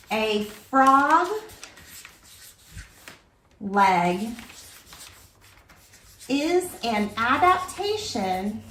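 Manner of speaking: slow, broken up by long pauses; dictating while writing. Real, not acted. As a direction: say it piece by piece as you write it down. Says sentence while writing it.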